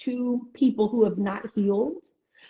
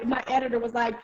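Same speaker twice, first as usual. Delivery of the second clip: normal talking voice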